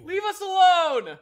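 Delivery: loud high yell; shouting. Real, not acted. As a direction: yell it at the attacker with full force. Shouting at attacking enemy.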